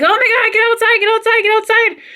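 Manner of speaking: high, frantic, repeated command; panicked retold cry. Owner ordering the retching dog outside, high-pitched panic.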